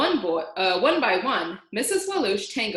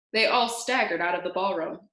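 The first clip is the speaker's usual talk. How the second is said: plain reading voice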